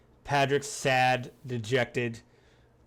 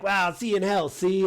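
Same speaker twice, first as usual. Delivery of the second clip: a hint of a 1920s American gangster, pitched higher and louder; 7 semitones higher, 5 dB louder than usual. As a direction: toss it off like an old-time movie tough guy, lightly imitated. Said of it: "Could you be American from the nineteen twenties?".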